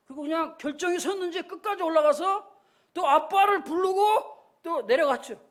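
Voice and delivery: normal talking voice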